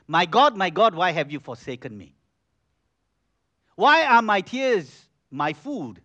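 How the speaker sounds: loud anguished lament, slowing at the end; anguished cry. Retold or imitated. David loudly cries out to God in anguish. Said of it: "He says".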